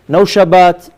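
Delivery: usual speaking voice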